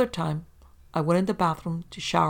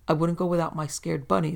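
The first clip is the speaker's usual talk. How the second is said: ordinary delivery, nothing marked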